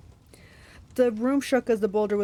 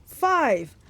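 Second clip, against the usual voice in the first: loud, raised one-word counteroffer; firm monster demand; monster haggling; 6 semitones higher, 5 dB louder than usual. A narrator's reading. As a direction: cut the bargain down as the monster.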